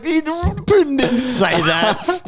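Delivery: high, drawn-out mock protest through laughter; laughing disbelief. Everyone reacting to the Boris story, laughing disbelief. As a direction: squeal it slowly as an incredulous crowd, amused.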